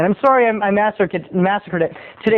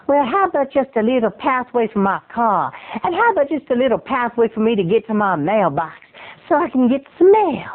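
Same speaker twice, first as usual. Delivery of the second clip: Southern lady; 4 semitones higher than usual. Acted. Southern neighbor asks for little pathway, Southern wheedling. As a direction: bargain him down with Southern sweetness. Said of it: "Southern hospitality".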